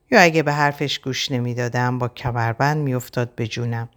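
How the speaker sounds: plain reading voice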